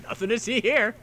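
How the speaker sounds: high, light officer voice, casually dismissive; mock officers. Officers give up on the search, high and breezy. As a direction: breeze past it like a bored officer. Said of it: "They're like".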